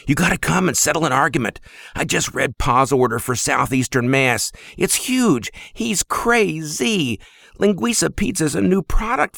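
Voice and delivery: high, fast and worked up; excited younger brother. Acted. Flour-covered brother begs Sock to settle an argument, fast and excited. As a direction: rush it out, all worked up.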